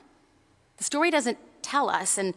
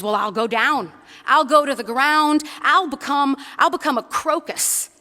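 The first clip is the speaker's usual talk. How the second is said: defiant bunny; 4 semitones higher, 6 dB louder than usual. Acted. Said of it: "And he says".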